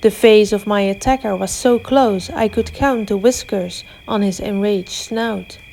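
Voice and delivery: usual speaking voice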